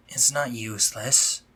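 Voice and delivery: usual speaking voice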